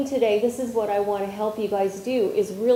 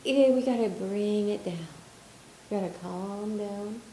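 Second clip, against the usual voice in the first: calming down; 5 dB quieter than usual. Real, not acted. Calming the group.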